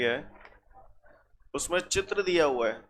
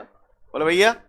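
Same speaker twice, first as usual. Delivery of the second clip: calling out; 9 dB louder, slower than usual. Real, not acted. Teacher calls to the class.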